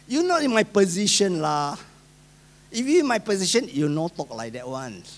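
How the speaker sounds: lightly voiced Singaporean English with lah; Singlish. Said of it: "Singlish for you a bit".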